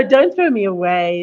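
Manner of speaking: loud imitation of children's cry; projected mock plea; mock call-out. Echoes the children's scream from clip.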